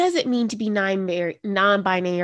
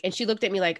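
normal talking voice

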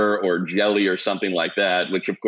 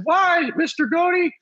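put-on much higher, slow, childlike "Why, Mister Goody?"; young boy voice; slower than usual. Little league kid asking his coach why, in a high boy voice. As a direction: ask like a small boy looking up at his coach.

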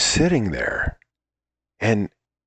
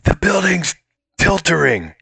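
hammed-up action-movie line, higher and pushed; flubbed film line; 8 semitones higher than usual. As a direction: deliver the line big and flub the word tiltering. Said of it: "I go leaning out and I go".

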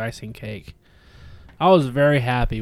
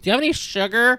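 higher, a little louder sweet ask; playful kid voice; 11 semitones higher, 4 dB louder, faster than usual. Pretend. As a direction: ask like a nineties kid at the door. Neighbor kid asking sugar.

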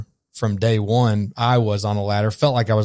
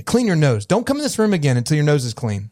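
higher, sharp scolding parent voice; raised stern parent telling off a child; 6 semitones higher than usual. Retold or imitated. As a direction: shame the kid out of the room. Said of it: "you show up snotty and say".

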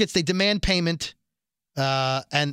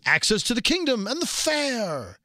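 higher, slow regal put-on voice; imitating King Ezekiel; 6 semitones higher than usual. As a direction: offer it grandly, like a king. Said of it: "King Ezekiel says".

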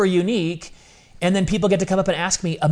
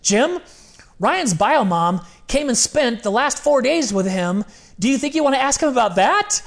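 high, pointed and firm; pointed mom voice; 8 semitones higher than usual. Retold or imitated. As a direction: press him to ask. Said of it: "And finally she goes".

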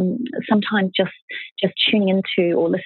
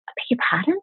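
high, incredulous I beg your pardon; mother stunned by her son's advice, incredulous; stunned disbelief; 8 semitones higher, much faster than usual. Retold or imitated. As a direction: gasp it out in disbelief. Stunned reply to son.

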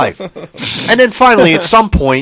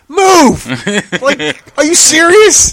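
high, loud, disbelieving shout; exasperated yell; 11 semitones higher, 4 dB louder, slower than usual. Retold or imitated. Yelling at the screen girl to move, exasperated. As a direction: yell at her to just move.

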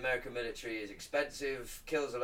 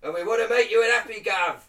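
high, loud hammed-up Cockney accent; Cockney Lord's Prayer; 7 semitones higher, 8 dB louder than usual. Reciting the Lord's Prayer to friends, loud mock Cockney. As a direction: belt out the prayer like a cheeky Cockney geezer. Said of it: "Do you wanna hear the Lord's Prayer in Cockney?".

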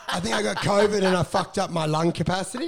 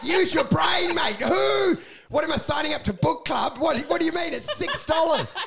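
exasperated rant; 9 semitones higher than usual. Retold or imitated. Comic ranting at a shop assistant over membership, exasperated. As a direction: berate a dim salesman, voice pitched way up.

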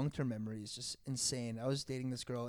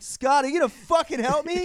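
higher, louder urgent plea; desperate demand; 15 dB louder than usual. Retold or imitated. Half-asleep friend begs Scott for help holding the bunk, loud. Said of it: "I'm like".

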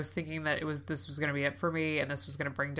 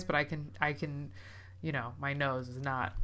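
normal talking voice

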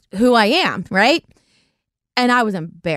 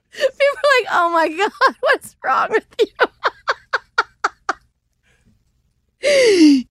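high, breaking up with laughter; laughing; 14 semitones higher than usual. Real, not acted. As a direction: barely get it out for laughing. Laughing hard at her own joke.

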